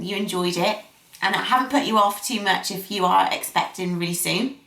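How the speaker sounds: ordinary talk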